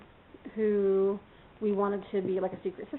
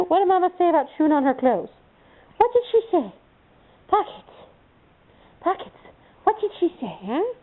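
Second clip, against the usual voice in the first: much higher, louder teasing questions; playful scolding pet talk; 8 semitones higher, 4 dB louder than usual. Real, not acted. Owner asking her puppy what Mama said, high teasing pet talk. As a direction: quiz the puppy like a mock-stern mother.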